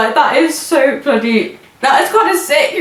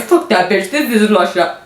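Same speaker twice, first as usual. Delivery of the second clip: lower-pitched attempt at a Russian accent; 4 semitones lower than usual. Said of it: "Is that a good Russian accent?".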